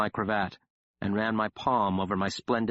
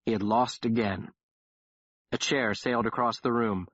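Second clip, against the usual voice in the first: usual speaking voice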